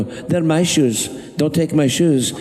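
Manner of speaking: mock possessive protest; Conrad arguing back. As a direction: plead and squabble as the little housekeeper. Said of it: "they get in an argument".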